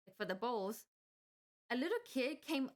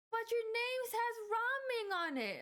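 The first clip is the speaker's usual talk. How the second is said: put-on high, slow kid's plea; disappointed little kid; 11 semitones higher than usual. Little kid pleading with the cashier, high and slow. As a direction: whine it like a let-down child. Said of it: "and it's like".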